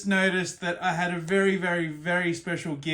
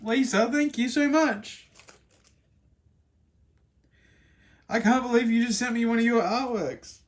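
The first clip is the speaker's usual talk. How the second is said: real tears; 3 semitones higher than usual. Real, not acted. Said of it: "I'm legitimately crying".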